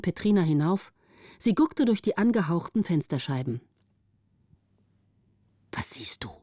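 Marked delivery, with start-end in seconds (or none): 5.7-6.3 very soft, low whisper. A narrator's reading. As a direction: whisper it up to the lookout. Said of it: "flüsterten die unten". (leises Flüstern von unten)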